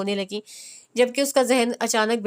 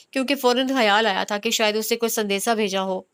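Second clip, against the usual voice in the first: plain reading voice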